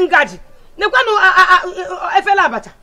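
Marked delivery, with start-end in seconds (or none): 0.8-2.2 loud, higher disbelieving laugh through words; laughing in disbelief. Acted. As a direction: laugh at how absurd the mess is.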